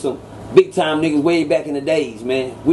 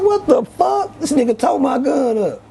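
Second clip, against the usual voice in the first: much higher, disbelieving cry at the ruined gun; stunned outrage; 8 semitones higher than usual. Retold or imitated. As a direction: cry out in shock at the wrecked gun. Friend wrecked his gun.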